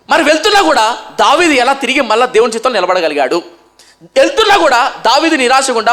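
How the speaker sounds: high, loud, fervent pulpit delivery; a rising, loud sermon cry; full-force preaching. Acted.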